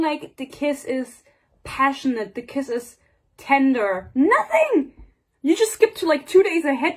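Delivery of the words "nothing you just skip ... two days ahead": much higher and louder, exasperated; outraged disbelief. Real, not acted. Reviewing the book, she rants about the skipped kiss. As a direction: sound appalled the scene was skipped.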